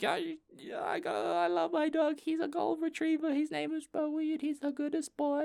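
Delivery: very high, squeaky dog-lover babble; loopy gushing. Acted. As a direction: gush in a silly high-pitched voice. Acts out his loopy gushing about dog.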